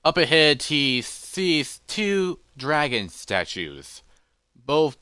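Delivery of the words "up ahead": plain reading voice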